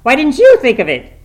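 shouted, very high frantic questions; shrieking, overwrought excitement; hysterical. A narrator's reading. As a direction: cry out hysterically at the missed idea. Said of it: "she said hysterically".

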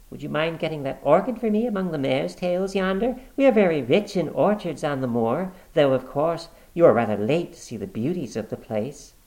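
high, lightly social woman's voice; casual politeness. Acted. Woman changing the subject for her brother, casually. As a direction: cover the warning with small talk.

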